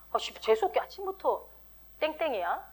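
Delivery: irritated grumble under the breath; muttered cursing. Acted. Believer grumbling at the office enemy, muttered cursing. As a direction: curse softly to yourself, acted. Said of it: "욕이 이제 확 나오는 거죠".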